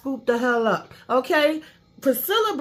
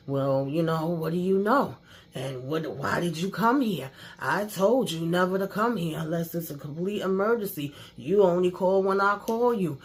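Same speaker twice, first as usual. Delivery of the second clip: a lower voice scolding the reporter; father voiced low and stern; mock stern father; 6 semitones lower, 4 dB quieter than usual. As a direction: play the annoyed man in charge, lower.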